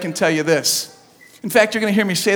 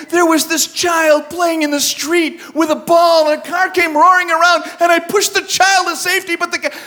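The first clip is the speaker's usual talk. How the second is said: heroic tale; 7 semitones higher than usual. Retold or imitated. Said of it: "I wanted to say".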